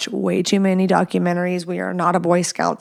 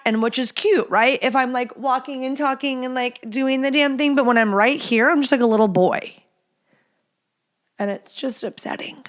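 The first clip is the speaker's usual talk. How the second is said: ordinary talk, nothing marked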